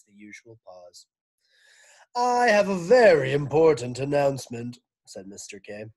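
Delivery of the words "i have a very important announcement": loud principal on the PA; over the loudspeaker. Acted. Mister Kidswatter greeting school, loud loudspeaker announcement. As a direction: boom it out over the speakers. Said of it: "voice came over the loudspeaker".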